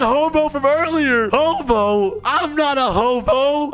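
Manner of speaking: high-pitched incredulous outburst; shocked indignation. Pretend. Player recognised as the hobo, shocked. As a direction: squeak with mock offence.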